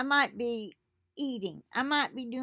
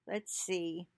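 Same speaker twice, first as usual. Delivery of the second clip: normal talking voice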